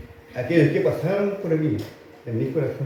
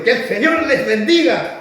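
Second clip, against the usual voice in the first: high, drawn-out shout to the congregation; loud, slow, pitched-up proclamation; rousing loud blessing; 9 semitones higher, 7 dB louder than usual. Shown as a demonstration. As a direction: shout the blessing so they roar back. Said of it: "En cambio, si, si yo le digo".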